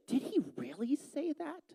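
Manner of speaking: high, soft, insinuating serpent whisper-tone; sly serpent. Retold or imitated. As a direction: coax it out like a sly tempter casting doubt. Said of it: "he's laying it on thick".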